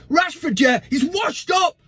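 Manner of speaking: high, mock shouting fan voice; mocking Saeed rant. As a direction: ham up an angry fan yelling to sack him. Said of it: "it is a dig at Saeed".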